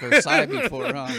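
chuckling through the words; amused at the story, laughing as you speak. Real, not acted.

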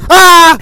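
high loud startled yell; sudden yell. Real, not acted. Jolting the ASMR listener with a loud yell.

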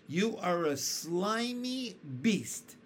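slow, changed centipede voice sneering; drawn-out contempt for the worm; centipede insult. A narrator's reading. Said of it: "answered the centipede".

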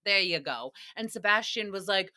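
ordinary talk

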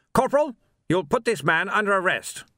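high, loud officer's barked order; commanding captain. A narrator's reading. Captain orders the sergeant to fire over the barricade, loud and commanding. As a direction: command the squad crisply.

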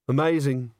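mildly glum response. A narrator's reading. Agnes answers her friend's exciting news, glumly. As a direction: say it with little enthusiasm. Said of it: "said Agnes glumly".

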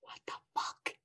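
breathless whispered disbelief; shocked whisper. Real, not acted. Player reacts to the camper's denial, whispering.

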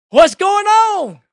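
high, drawn-out shout of a man jolted awake; startled shout. Retold or imitated. Pilot waking up as the plane stops, startled shout. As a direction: yell it out loud, stretching the words.

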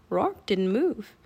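plain reading voice

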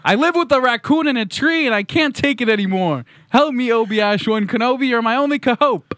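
high-pitched, desperate plea; pleading letter-writer. Acted.

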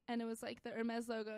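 ordinary delivery

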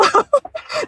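a high rising giggle. Real, not acted. Host giggles about freedom in fan audio dramas, high giggle. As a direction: giggle breaking into the sentence. Laughs mid-sentence.

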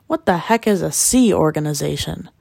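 usual speaking voice

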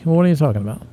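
normal talking voice

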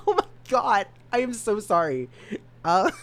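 high, through laughter; can't stop laughing; laughing apology. Real, not acted. Laughing apology after stutter.

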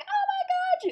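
imitated very high-pitched squeal; a thin mock scream, way up high; screaming mom. Mom on the phone to daughter, screaming. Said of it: "she's screaming".

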